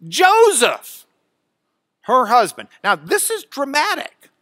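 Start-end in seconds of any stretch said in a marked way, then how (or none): 0.1-1.0 "Joseph" loud and high, first syllable held; emphatic name. Shown as a demonstration. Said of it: "Greek word order for emphasis". 2.0-2.7 "her husband" firmly louder; stress on phrase. Shown as a demonstration. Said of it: "when you get this emphasis".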